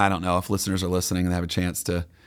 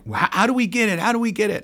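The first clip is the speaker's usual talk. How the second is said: eager fans; 13 semitones higher, faster than usual. Retold or imitated. Voicing eager fans asking.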